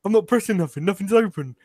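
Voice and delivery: high-pitched mock panic; imitated freak-out. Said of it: "he's, like, freaking out".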